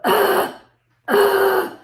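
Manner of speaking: very loud exasperated "ugh"; loud, high groan of a mom losing patience; frustrated groan. Shown as a demonstration. Mom showing what she does when stressed, loud frustrated groan. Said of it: "that's my frustrated voice".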